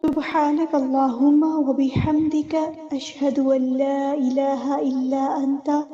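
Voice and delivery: slow, soft devotional recitation; recited closing prayer. Real, not acted. Teacher ending the class with a slow, soft prayer. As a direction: murmur the closing dua slowly and reverently.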